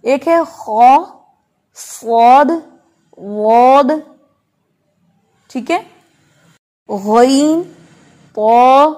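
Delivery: each letter said slow and full in turn; heavy letters drilled. Shown as a demonstration. Teacher repeats the letters so students can check. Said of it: "अब मैं दोबारा बोलती हूँ".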